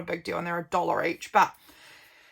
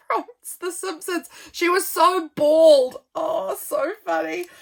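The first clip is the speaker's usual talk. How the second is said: amused exclamation; 7 semitones higher, 7 dB louder than usual. Real, not acted. Laughing at an old photo.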